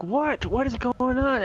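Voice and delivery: high-pitched mock bewilderment; alarmed questioning. Gamer asking a friend what is going on, alarmed.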